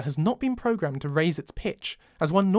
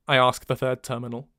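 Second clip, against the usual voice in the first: plain reading voice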